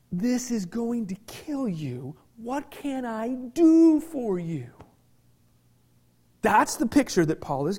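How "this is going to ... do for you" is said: gentle compassion. Pretend. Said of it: "Going to this brother or sister and going".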